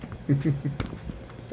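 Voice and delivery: soft airy laugh at the cat; a quiet breathless chuckle; breathy giggle. Real, not acted. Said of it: "Sorry, I'm laughing".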